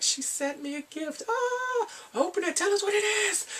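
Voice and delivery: high falsetto squeal; mock excitement. Chat room thrilled about a gift, mock excited squealing.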